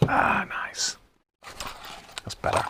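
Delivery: breathy whisper of pleasure after a sip; whispered relish. Real, not acted. Enjoying his first Sunday beer in a whisper. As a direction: sigh it out, savouring the beer.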